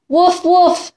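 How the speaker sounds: dog bark. Acted. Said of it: "said the dog".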